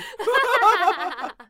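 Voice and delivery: squealing laugh; shrieking with laughter; high burst of laughter. Real, not acted. Parody cracks her up.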